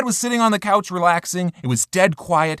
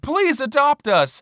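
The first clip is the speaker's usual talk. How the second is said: cute pleading sign voice; 7 semitones higher, slower than usual. Pretend. Reading cute pet store sign.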